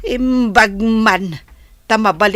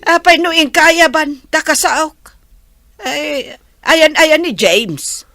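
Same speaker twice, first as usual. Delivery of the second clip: higher, louder agitated calling; 6 semitones higher, 3 dB louder than usual. Acted. Mother calls out for James at the site, agitated. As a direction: call out urgently, pitched up. Mother demands to see engineer.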